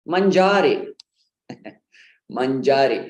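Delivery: mangiare as man-JAA-ri; man-JAA-ri for mangiare, like the Italian verb; mispronounced manjari. Shown as a demonstration. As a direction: stress and stretch the middle syllable of mangiare. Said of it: "is mispronounced as".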